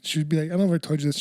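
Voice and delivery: usual speaking voice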